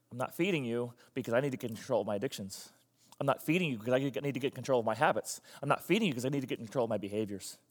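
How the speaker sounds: low, soft and resolute; calm refusal. Acted. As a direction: answer the nagging quietly and firmly. Person calmly refuses the nagging stomach.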